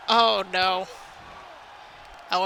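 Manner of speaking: ordinary delivery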